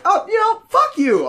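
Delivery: high, loud exasperated outburst; mock furious. Offended woman before storming off, mock fury. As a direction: imitate her storming off. Woman ends the quarrel angrily.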